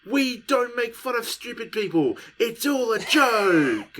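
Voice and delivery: high-pitched, emphatic protest; exasperated rant. Real, not acted. Podcaster ranting at critics who can't take a joke, exasperated. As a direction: plead loudly in exasperation, drawing out the last words.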